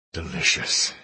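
breathy whispered word. A narrator's reading. Hero murmurs in intimacy.

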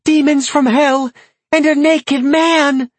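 high shrieking woman, slowing at the end. A narrator's reading. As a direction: scream in horror. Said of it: "the woman shrieked".

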